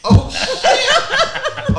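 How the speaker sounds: shrieking laugh then settling. Real, not acted. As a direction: laugh in shocked delight. Caught out by friends' teasing.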